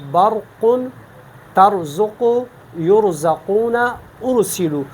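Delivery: ra in برق and ترزق said full and heavy; thick, full-mouthed R in یرزقون. Shown as a demonstration. Tajweed teacher giving examples of ra sakin, heavy ra. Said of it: "ہم پر پڑھتے ہیں".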